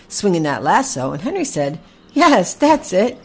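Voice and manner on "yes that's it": raised, louder cheering-on; encouraging coach. A narrator's reading. Horse Henry coaches Packy with the lasso, excited encouragement. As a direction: urge a beginner on with eager, high energy.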